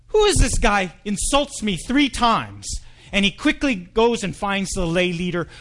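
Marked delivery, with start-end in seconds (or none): 0.1-2.7 high, indignant inner outburst, slowing down; mad. Acted. Pastor stews over the old man's insults, mildly angry. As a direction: fume to yourself, insulted. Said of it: "Now the new pastor's mad".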